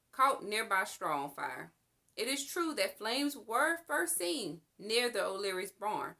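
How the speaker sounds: usual speaking voice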